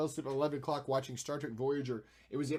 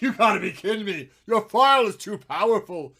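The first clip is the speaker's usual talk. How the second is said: loud mock outrage; 7 semitones higher, 14 dB louder than usual. Mock computer complaint.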